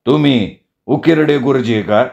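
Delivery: ordinary delivery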